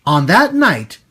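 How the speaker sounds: plain reading voice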